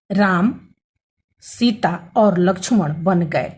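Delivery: Ram, a clear stop, then Sita और लक्ष्मण बन गए slowly; pause after Ram. Shown as a demonstration. Teacher reads an example of the comma, pausing after Ram. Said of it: "थोड़ा रुकना है".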